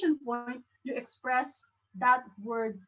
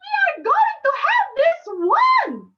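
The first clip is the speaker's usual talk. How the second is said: high, loud, soaring exclamation; big shout demo; 9 dB louder than usual. Shown as a demonstration. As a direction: belt it out like a rally cry. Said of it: "we are trying to make it like".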